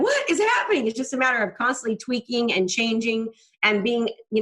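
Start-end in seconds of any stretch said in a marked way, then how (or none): 0.0-0.9 higher, baffled cry; mock incredulous outburst; bewildered disbelief. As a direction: ask it as if the floor dropped out. Retold bewilderment during sales slump.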